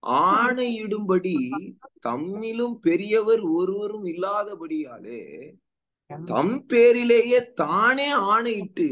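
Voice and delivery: preacher reading out a verse, stretched and high; scripture reading. Acted. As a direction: proclaim the verse, building in pitch and force. Reads scripture aloud.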